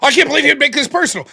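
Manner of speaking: high, loud, fast indignant whine; mock indignation. Mocking a debater who cries foul, high fast whine. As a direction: mimic a caller playing the victim.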